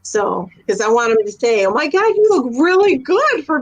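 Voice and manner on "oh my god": ordinary talk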